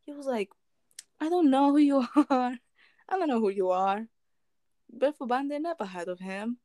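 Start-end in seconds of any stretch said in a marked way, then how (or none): 1.2-4.1 playing dumb, laughing through it; a light mock of a man playing dumb; acting dumb. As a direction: feign total ignorance. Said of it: "the guy was acting dumb".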